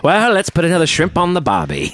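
high, hammed-up Aussie accent; mock Australian accent. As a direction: swoop up into a cartoon Australian catchphrase. Joking Aussie catchphrase after Australia mention.

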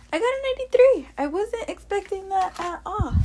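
high, loud, thrilled; excited. Retold or imitated. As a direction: gush with joy over the grade. Reliving the good grade.

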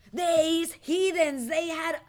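loud scorn. Retold or imitated. Scornful look at other shoppers.

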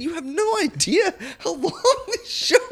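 high giggling pleading; real laughter through the plug; laughing plea. Real, not acted. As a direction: beg them to listen while laughing.

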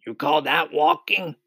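high-pitched scoffing question; scoffing centipede. A narrator's reading. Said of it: "cried the centipede".

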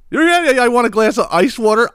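high, loud mocking voice; mock thirsty guest. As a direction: parody someone eagerly asking for ice water. Mocking guest asking ice water.

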